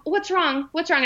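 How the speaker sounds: high, urgent retold questioning; acted-out anxious pleading; frantic worry. Retelling frantic questions to mom.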